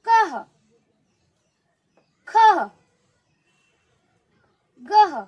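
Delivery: कह, खह, गह as separate loud bursts; short "kaha, khaha, gaha" with long pauses between; visarga breath. Shown as a demonstration. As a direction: model कह, खह, गह, each ending in breathy h. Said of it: "एक बार फिर से देखें".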